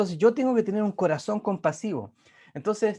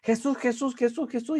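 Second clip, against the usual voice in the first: imitated shouting; 7 semitones higher than usual. Preacher imitating a woman shouting Jesús at a protester. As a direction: call out the name like a confrontation. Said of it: "empieza a gritarle".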